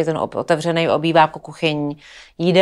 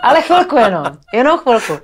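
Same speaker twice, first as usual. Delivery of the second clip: laughing; 5 semitones higher, 5 dB louder than usual. Real, not acted. Laughing at own funeral wish.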